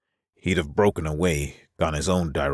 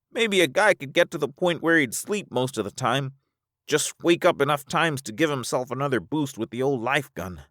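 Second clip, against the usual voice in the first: high-voiced Alvar, dismissive; 11 semitones higher than usual. Alvar dismissing staying together, high-pitched voice. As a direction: brush it off in a high register.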